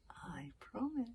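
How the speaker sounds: slow, breathy whisper with a false smile; whispered promise. A narrator's reading. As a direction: promise it softly, too sweetly. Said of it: "smiled Alvin".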